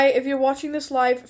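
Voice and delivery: ordinary delivery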